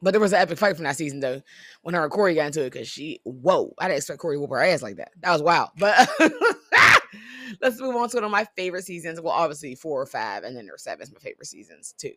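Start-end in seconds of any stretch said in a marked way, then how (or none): 6.1-7.6 loud, high laugh breaking out; burst of laughter. Real, not acted. Laughing about the wild fight.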